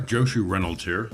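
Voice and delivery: ordinary delivery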